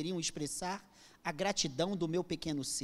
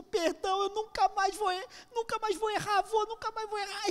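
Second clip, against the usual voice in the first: put-on begging child; 4 dB louder than usual. Boy crawling begging grandfather's forgiveness.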